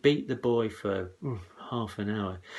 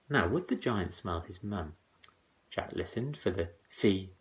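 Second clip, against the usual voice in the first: ordinary delivery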